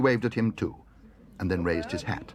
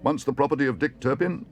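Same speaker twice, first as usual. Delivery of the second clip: raised, showy auctioneer's pitch; auctioneer's call; 6 semitones higher, 4 dB louder than usual. A narrator's reading. Auctioneer praising the pistol's history, calling out. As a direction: talk up the lot to the hall. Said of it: "called the auctioneer".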